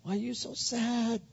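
high, softening husband voice; empathizing husband. Acted. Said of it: "he seeks to enter into where she's at".

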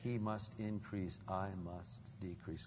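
low, soft, humble declaration; John. Acted. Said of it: "John says".

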